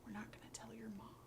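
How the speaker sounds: very soft, near-whisper; dad quietly swearing his kid to secrecy; hushed secret. Acted. Dad confides secret in the car.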